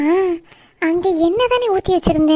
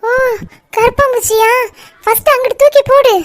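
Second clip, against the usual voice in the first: frightened shriek; 6 semitones higher, 4 dB louder than usual. Acted. Aunty panics at cockroach.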